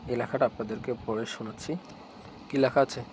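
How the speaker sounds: normal talking voice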